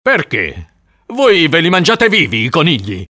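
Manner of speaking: exasperated, sarcastic comeback. A narrator's reading. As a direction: snap back out of patience. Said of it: "L'uomo era esasperato".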